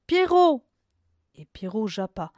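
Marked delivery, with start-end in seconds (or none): 0.1-0.6 loud, high call down the well. A narrator's reading. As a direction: shout the dog's name into the pit. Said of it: "Rose cria".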